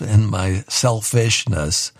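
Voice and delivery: usual speaking voice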